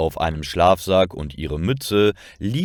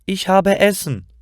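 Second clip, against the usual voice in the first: high, happy exclamation; joyful loud call; 11 semitones higher, 4 dB louder than usual. A narrator's reading. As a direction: call it out gleefully, pitched well up. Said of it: "ruft laut".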